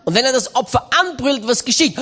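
usual speaking voice

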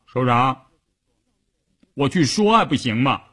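mild sulk, near everyday pitch; pouting concession. A narrator's reading. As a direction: give in with a small pout. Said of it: "嘟着嘴说". (嘟着嘴不情愿地说)

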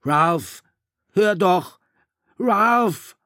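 high, loud desperate shout; Piggy screaming. A narrator's reading. Piggy screaming after Ralph to stop and listen. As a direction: yell after the running boy. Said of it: "Piggy schrie".

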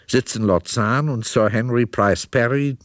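ordinary delivery

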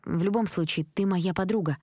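ordinary delivery

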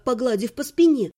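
usual speaking voice

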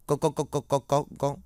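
Mạnh stammering to the guard in fright; terrified stammer. Acted. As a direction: trip over every word in fear.

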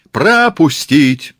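high, loud, drawn-out command; bellowed order. Acted. Murat orders crowd to make way, bellowing. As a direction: roar it slowly over the crowd.

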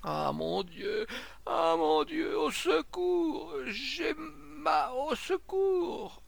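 long, very high howl of pain; slow, high hysterical wail; ululating wail. Acted. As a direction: draw the cry out into a shrieking plea. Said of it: "Le ululement de cette plainte insolite".

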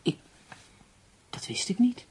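whispered in shock, breath only. Acted. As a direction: let the news knock the voice out of her. Shocked by news of her hanging. (geschokt, gefluisterd van ontzetting)

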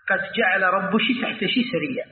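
"رب شي تحت شي" with shi replacing the k; Kashkasha reading. Shown as a demonstration. Teacher quoting a rare recitation, Kashkasha shi endings. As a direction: recite the verse with "شي" for the pronoun ki. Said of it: "هذا من لهجات العرب".

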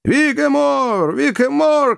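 very high, drawn-out jubilant cry; joyful shouting. A narrator's reading. Joe whoops as the elephant tows them, shouting joyfully. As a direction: whoop it slowly, far up high. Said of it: "продолжал радостно кричать Джо".

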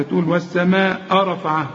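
ordinary delivery

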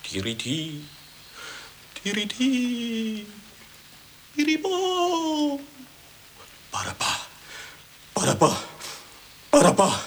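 declaim, climbing in pitch; dramatic poem recital. Acted. Poet declaims same poem.

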